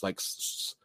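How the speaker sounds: unvoiced hissing S sounds; breathy mock S; hissed S. Shown as a demonstration. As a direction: hiss the S like a lisp. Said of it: "there's a lot of S's".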